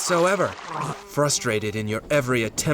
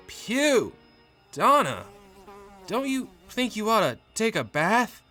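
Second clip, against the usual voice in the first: high-pitched recoil from a stench; disgusted husband; 10 semitones higher than usual. Acted. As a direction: plead politely through the revulsion. Husband recoils from rotting wife's stench.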